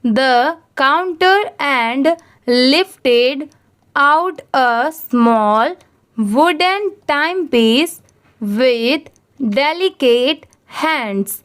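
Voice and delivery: very slow, word by word, for students to repeat; very slow read-along. Shown as a demonstration. Teacher reading to students who repeat each phrase, very slow. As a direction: spell out each phrase very slowly so the class can echo it. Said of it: "आप मेरे बात ज़रूर repeat करिए".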